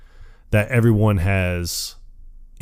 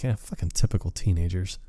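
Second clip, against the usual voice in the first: sarcastic aside; 2 semitones lower, 9 dB quieter than usual. Retold or imitated. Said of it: "And I'm like".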